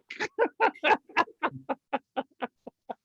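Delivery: long high-pitched laugh at own joke; drawn-out, genuine laughing fit; real laughter. Real, not acted. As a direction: crack up over your own punchline. Laughing at own joke's punchline.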